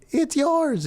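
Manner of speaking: high-pitched Greek mom impression; little Greek voice. Greek mom answering her concussed son, little high Greek voice. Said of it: "in her little Greek voice".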